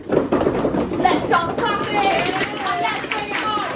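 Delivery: loud, drawn-out, rising crowd call; hype announcer. Real, not acted. Compere bringing on the next act, shouted announcer hype. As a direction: whip the crowd up, stretching every word.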